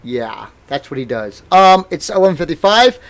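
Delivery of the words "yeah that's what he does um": ordinary delivery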